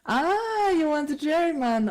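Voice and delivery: a high surprised cry, then a question; real surprise, voice jumping high. Real, not acted. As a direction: caught off guard by the request. Surprised by a request.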